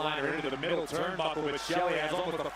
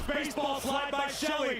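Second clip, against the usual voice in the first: excited; 9 semitones higher than usual. Real, not acted. Fast move called live.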